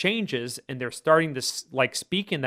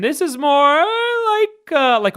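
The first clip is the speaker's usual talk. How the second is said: much higher, slow cackle-like tone; cackling sound; 14 semitones higher, 7 dB louder, much slower than usual. Shown as a demonstration. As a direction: crack up into a cackling head tone. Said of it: "It's more like a cackling sound".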